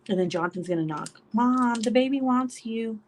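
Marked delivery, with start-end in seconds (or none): 1.3-3.0 slow, higher imitation of a kid calling; sing-song mock kid's call; child calling mom. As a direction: draw it out like a child summoning mom. Imitating child calling through the door.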